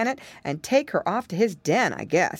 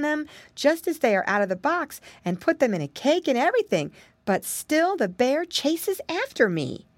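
lightly voiced girl, mostly higher-pitched; worried little girl; 8 semitones higher than usual. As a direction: explain your trouble like a fretting child. Voices worried Cora Janet.

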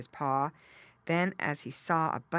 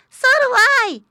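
very high, loud squeaky piglet; little girl pig; 16 dB louder than usual. A narrator's reading.

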